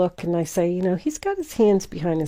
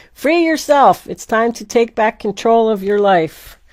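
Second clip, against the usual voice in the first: excited card reading; 3 semitones higher, 7 dB louder than usual. Acted. Reading the card's message aloud.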